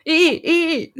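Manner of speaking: lightly voiced lawyer objecting; playful high objection call; mock objection. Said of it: "你一讲话，我就一直".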